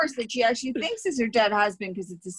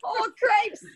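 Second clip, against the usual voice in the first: mock dismay; 13 semitones higher than usual. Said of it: "she does this instead".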